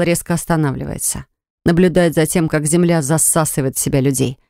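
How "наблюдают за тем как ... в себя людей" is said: ordinary delivery, nothing marked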